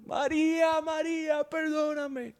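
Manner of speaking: very high, strained cry of a name; retold anguished scream. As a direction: wail it high like a broken father begging. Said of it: "starts screaming".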